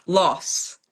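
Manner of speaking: usual speaking voice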